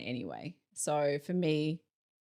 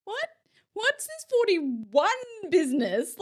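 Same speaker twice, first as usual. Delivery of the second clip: high, slow, incredulous outburst; drawn-out mock alarm, much higher than her usual voice; shocked disbelief; 13 semitones higher, 4 dB louder, slower than usual. Retelling her reaction to turning forty-one, slow high mock shock. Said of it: "I was like".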